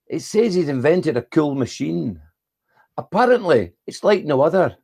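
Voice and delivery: put-on Scottish accent. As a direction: recite it in a lilting Scottish accent. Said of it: "do it in a Scottish accent".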